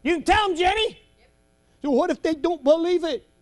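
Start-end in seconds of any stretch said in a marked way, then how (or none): none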